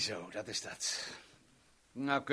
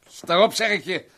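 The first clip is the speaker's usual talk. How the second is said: loud angry bark to get up; furious command; 5 semitones higher, 11 dB louder than usual. Acted. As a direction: yell it as a final warning. Fight, repeating order.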